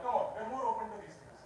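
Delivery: usual speaking voice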